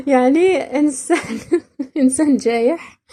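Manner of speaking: giggling through the word; real laugh breaking in; laughing while explaining. Real, not acted. Laughing at the meaning.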